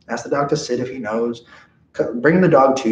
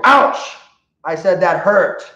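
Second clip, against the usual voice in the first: high, loud yelp then a firm "I said that hurt"; firm ouch; 6 semitones higher, 5 dB louder, slower than usual. Shown as a demonstration. Trainer showing owners the second warning, loud yelp. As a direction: startle the dog with a hurt cry. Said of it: "where we're gonna go".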